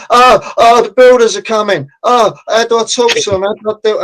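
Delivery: high, fluttering mock fright; mock panic. Property group posters panicking about builders, high mock fright. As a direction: squeal it like a nervous homeowner. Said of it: "you see 'em in Facebook".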